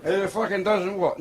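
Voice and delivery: drawn-out Scottish bafflement; drunk Scotsman. Acted. Drunk Scot puzzled by a Welshman, slow Scottish accent. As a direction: question him slowly in broad Scottish. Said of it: "imagine a Scotsman pissed".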